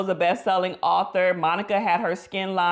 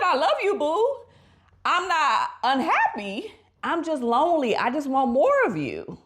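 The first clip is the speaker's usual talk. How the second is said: wife's loving plea; 8 semitones higher than usual. Retold or imitated. Wife telling her husband she is lonely, high and pleading. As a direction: plead sweetly in a raised voice. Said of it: "She said".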